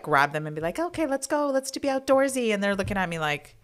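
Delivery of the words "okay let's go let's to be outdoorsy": put-on high, bright coaxing to the kids; pep the kids up to go outside, much higher; mock cheery mom. Mom coaxing kids outdoors, higher and cheery.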